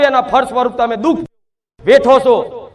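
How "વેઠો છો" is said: usual speaking voice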